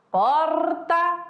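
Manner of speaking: porta with a tapped R; yet another R. Shown as a demonstration. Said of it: "quatro formas".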